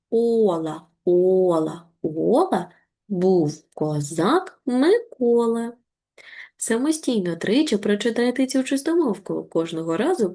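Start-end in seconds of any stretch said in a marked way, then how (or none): 0.1-5.7 very slow. Shown as a demonstration. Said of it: "читаємо, як черепашки, дуже повільно".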